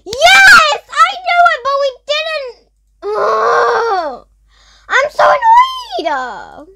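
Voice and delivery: high, loud, drawn-out annoyed yells; upset high screeching complaint; frustrated shouting. Real, not acted. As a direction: wail your frustration at losing. Lost the round, frustrated.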